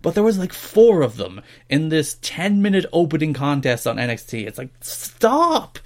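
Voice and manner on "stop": higher, slow drawn-out stop, begging him to quit; exasperated plea. Real, not acted. Mocking co-host, fed up with the announcer's puns, exasperated pleading. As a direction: plead it out like you can't take any more.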